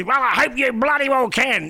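high, loud mock Hawke snapping back; Bob Hawke impression. Hawke retorting to a cheeky interviewer, imitated high and loud. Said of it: "he would say".

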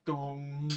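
low, soft "dong" like a bell; imitated bell toll. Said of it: "then heard the Undertaker bell".

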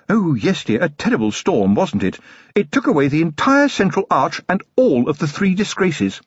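a fond, well-bred wife; Lady Sybil, genteel lady. Acted. Wife cutting in on her husband, refined lady.

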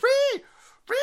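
very high, loud "ree" screech; long piercing comic shriek; mock screech. Imitated screech noise.

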